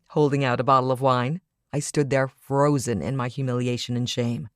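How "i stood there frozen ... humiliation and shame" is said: ordinary delivery, nothing marked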